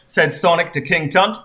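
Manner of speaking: ordinary delivery, nothing marked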